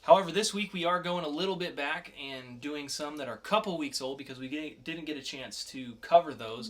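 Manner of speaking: loud, upbeat host intro; podcast host opening the comic review; segment intro. Acted. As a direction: pitch the segment like a show host.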